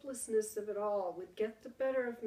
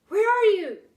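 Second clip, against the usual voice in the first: high loud excited shout; calling out; 11 semitones higher, 14 dB louder than usual. Acted. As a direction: shout toward the voice. Boy calling toward the voice.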